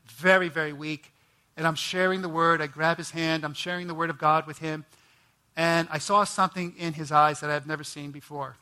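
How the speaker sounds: usual speaking voice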